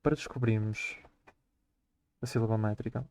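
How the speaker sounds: usual speaking voice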